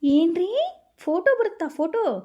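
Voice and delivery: high, loud delighted cry; excited squeal. Acted. Wife excited about photo.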